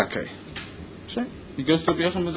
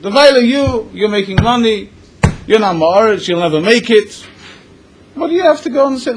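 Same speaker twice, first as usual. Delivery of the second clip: raised, higher, scornful father berating his grown son; louder and higher, brushing off his son's dream; scolding father; 6 semitones higher, 5 dB louder than usual. Retold or imitated. A father berates his son for wanting to learn, scornful and loud.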